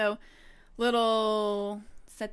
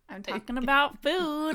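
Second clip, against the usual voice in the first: usual speaking voice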